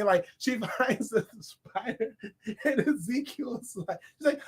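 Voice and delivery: ordinary talk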